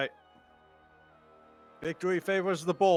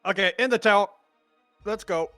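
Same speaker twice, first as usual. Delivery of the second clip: raised urgent call; 5 semitones higher, 6 dB louder, faster than usual. Acted. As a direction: hurry them into the tower. Urgent in-game rush.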